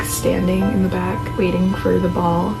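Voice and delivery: ordinary delivery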